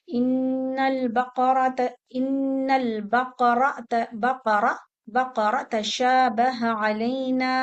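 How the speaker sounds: drawn-out chanted Quranic recitation; slow recitation. Shown as a demonstration. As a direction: chant it slowly and evenly.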